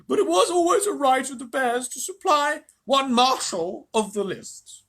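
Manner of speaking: slow, high, loud bear; thick, sleepy bear voice. A narrator's reading. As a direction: labour through it like a dopey old bear. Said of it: "sleepy voice said".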